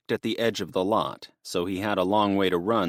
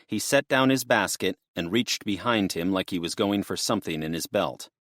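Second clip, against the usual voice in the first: usual speaking voice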